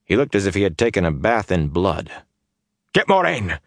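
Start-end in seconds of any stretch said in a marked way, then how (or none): 2.9-3.6 high, sharp urgent command; snapped. A narrator's reading. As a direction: snap the order out. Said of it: "Perrin snapped at the Aiel women".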